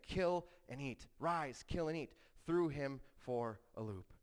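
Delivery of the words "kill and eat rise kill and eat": higher, lightly commanding quote; voice of the Lord. Retold or imitated. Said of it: "the voice of the Lord saying".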